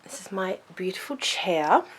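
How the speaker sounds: ordinary talk, nothing marked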